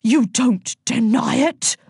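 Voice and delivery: slow, high-pitched disbelief; affronted, incredulous official. A narrator's reading. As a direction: stretch each word in astonishment. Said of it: "said the incredulous Millicent".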